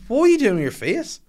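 high, loud mimicked demand; imitated indignant challenge. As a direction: challenge him with pitch leaping up. Retold confrontation over his faces.

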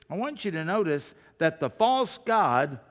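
normal talking voice

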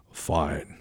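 low, soft presidential reply; imitated deep, understated acknowledgement; Nixon. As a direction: drop the voice into a brief, quiet assent. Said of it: "all the president would say would be".

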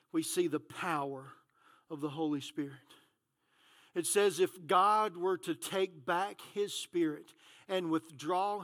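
low, soft, measured pulpit voice; solemn scripture reading. Real, not acted. Preacher showing the Spirit's power from Job, hushed and solemn. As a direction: read it quietly, pausing between phrases.

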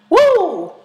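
a loud, high cheering whoop; excited whoop. Real, not acted. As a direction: let out a real whoop of excitement. Cheering for tall guys.

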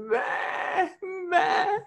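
high, tearful mock sheep bleat; whimpery kid's sheep noise; crying child bleating. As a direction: imitate a little boy baa-ing through tears. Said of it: "he's kinda crying. He's like".

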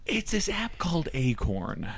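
high-pitched, slow celebrity impression; slow high put-on voice; Ashton Kutcher. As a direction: draw it out slowly, pitched well up. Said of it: "That's my best Ashton Kutcher".

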